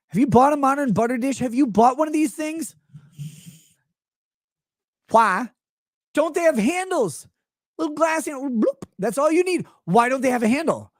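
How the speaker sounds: high-pitched, incredulous questioning; worked-up comic exasperation; exasperated rant. Real, not acted. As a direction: demand an answer, pitched well up. Comic rant about butter dishes.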